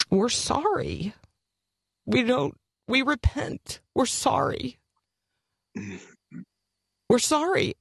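rising, anguished plea for forgiveness; pleading repentance. Real, not acted. Pastor repents in prayer.

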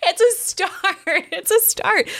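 said high through laughter; laughing. Real, not acted. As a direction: laugh the words out. Laughing while repeating line.

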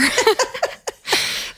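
high, wheezy burst of laughter; amused laugh. Real, not acted. Survivor laughing at God's sense of humor, high amused laugh. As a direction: laugh helplessly at your own wry remark. Laughs at her own remark.

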